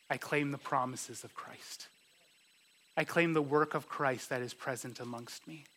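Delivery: normal talking voice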